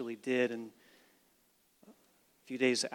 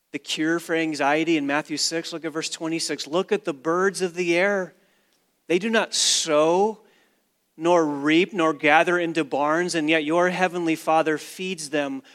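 loud, higher pulpit preaching; fervent sermon delivery building on "father"; full-force preaching; 4 semitones higher, 8 dB louder than usual. Acted. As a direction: preach it out to the back row.

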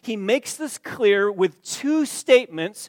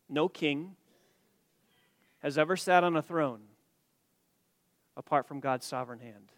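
ordinary talk

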